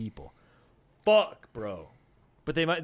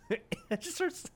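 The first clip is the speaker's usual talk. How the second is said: laughing; 13 semitones higher, 8 dB quieter, slower than usual. Real, not acted. Laughing, high squeak.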